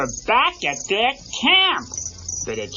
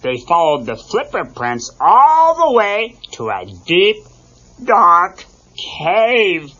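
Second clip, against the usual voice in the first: plain reading voice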